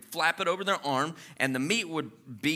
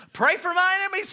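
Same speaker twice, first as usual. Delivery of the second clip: incredulous; 13 semitones higher, 4 dB louder than usual. Retold or imitated. Mock incredulous churchgoer.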